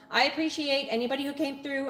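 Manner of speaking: ordinary talk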